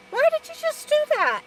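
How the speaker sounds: high, fast exasperated question; incredulous at careless kids; exasperated why. Retold or imitated. Vlogger retelling kids breaking limbs, incredulous.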